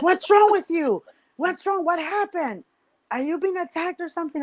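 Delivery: high, urgent questioning; alarmed sister. Retold or imitated. Older sister asking what happened, acted alarm.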